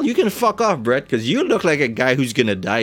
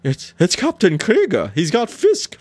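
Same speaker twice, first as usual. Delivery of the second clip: alarmed servant; 8 semitones higher than usual. Acted. Panicked servant voiced in scene.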